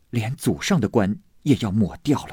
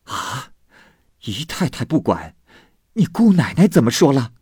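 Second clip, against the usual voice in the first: louder, higher, angry anxious demand; 5 semitones higher, 4 dB louder than usual. A narrator's reading. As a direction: ask in a worried, rising temper. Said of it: "又气又急道". (又气又急地质问，声音偏高偏响)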